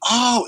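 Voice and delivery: high-pitched reacting "oh"; imitated "oh". Principal hears the Spider-Man motto, high imitated "oh". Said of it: "And she went".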